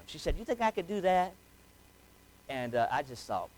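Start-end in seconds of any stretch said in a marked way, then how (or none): 0.4-1.3 a dad lightly voicing his small daughter; little girl voice. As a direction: imitate a three-year-old full of excitement. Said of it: "three-year-old little voice".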